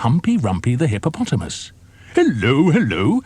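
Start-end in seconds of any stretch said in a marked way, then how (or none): 2.1-3.2 higher, louder hearty greeting; cheerful hippo. A narrator's reading. Hippo greeting the crocodile, cheerful and loud. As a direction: call out a jolly hello. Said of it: "said Humpy Rumpy".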